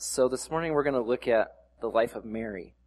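normal talking voice

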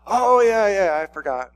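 loud, high careless brush-off; offhand pastor. Retold or imitated. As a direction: toss it off like a busy adult who forgot.

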